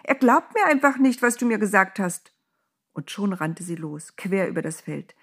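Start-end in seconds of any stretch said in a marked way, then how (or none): 0.0-2.3 high-pitched excited outburst; agitated call, easing off at the end; calling out urgently. A narrator's reading. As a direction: blurt it out high and insistent. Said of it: "rief sie dem Engel entgegen".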